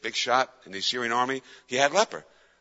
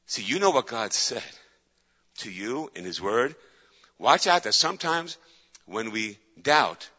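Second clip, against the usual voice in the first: normal talking voice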